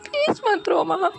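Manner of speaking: high, pleading voice near tears; tearful pleading. Acted. Daughter begging her weeping mother, high and tearful. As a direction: beg her mother not to cry.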